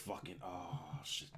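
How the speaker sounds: normal talking voice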